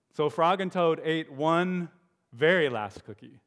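put-on lively read-aloud for children; animated story narration; storybook reading. A narrator's reading. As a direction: read it like a bedtime picture book.

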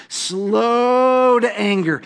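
slow to anger, stretched high and long; slow, drawn out. Shown as a demonstration. Preacher savoring slow to anger for the congregation, drawn out. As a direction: let each word of slow to anger linger.

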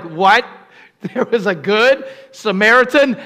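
mock disbelief. Listeners' shock voiced.

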